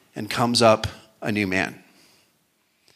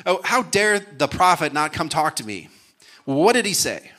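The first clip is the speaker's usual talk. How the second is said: indignant, raised-pitch outburst from an offended commander; mock furious; 7 semitones higher than usual. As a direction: play offended outrage, lightly hammed up. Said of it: "Naaman's furious".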